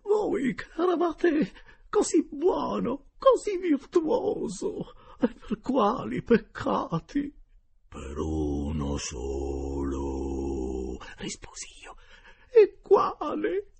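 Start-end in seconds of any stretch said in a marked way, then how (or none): 0.0-7.3 much higher, loud and slow tearful wail; wailing lady. A narrator's reading. Geltrude begging the uncle's spirit, slow high tearful wail. 7.9-11.0 lower, very slow sepulchral tone; ghost voice. A narrator's reading. Boy as the dead uncle answering, slow low ghost voice. 12.5-13.7 much higher, loud and slow tearful wail; wailing lady. A narrator's reading. Geltrude begging the uncle's spirit, slow high tearful wail.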